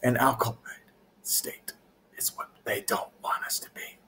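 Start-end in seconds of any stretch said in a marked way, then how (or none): 1.2-4.0 whispered secret, much softer; conspiratorial whisper. Acted. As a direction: hush it like a conspiracy theory. Conspiracy aside, hushed.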